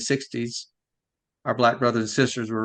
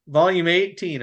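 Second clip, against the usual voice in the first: usual speaking voice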